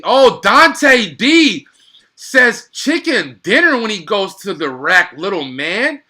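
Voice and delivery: loud, drawn-out hype call; big play-by-play roar; excited call. Real, not acted. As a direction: shout it like the crowd just rose.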